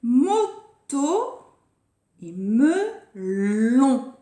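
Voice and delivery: Moto and melon pulled apart into strong syllables. Shown as a demonstration. Teacher giving pupils the next game words, syllable by syllable. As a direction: break up Moto, then stretch melon slowly.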